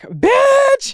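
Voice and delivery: very high shrieked exclamation. Retold or imitated. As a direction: shriek it in starstruck disbelief.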